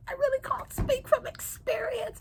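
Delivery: embarrassed whispered aside. Real, not acted. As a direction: drop to a shy whisper. Bashful aside about getting flirted.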